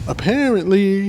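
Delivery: slow, high apparently, teasing the drinking-game word; mock emphatic apparently. Drinking-game word said high and slow to tease. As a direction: stretch apparently up high as a running joke. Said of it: "If you took a shot every time".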